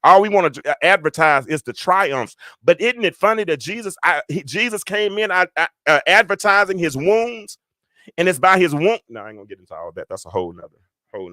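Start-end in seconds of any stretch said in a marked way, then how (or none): 0.0-9.0 high, loud preacher's fervor; impassioned high-pitched sermon delivery; preaching. Real, not acted. Preaches to online congregation.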